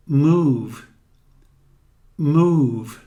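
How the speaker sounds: "Move" with a long drawn u. Shown as a demonstration. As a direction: stretch the vowel in "Move". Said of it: "Este som de U é bem longo".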